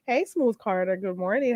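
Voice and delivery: usual speaking voice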